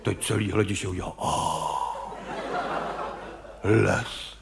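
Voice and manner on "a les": a hushed, low, awed "ah"; low hushed wonder; mock awed gasp. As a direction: gasp like a dazzled theatre crowd. Audience gasps at stage forest.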